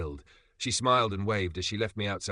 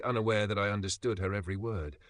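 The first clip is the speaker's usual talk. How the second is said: ordinary delivery, nothing marked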